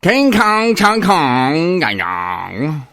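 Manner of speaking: loud, high gibberish shouting; mock Chinese gibberish. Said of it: "sounds angrier than I think".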